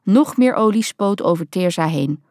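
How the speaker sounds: ordinary delivery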